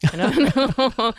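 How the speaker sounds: slow, laughing through words. Real, not acted. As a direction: giggle it out slowly. Laughing repeat of own words.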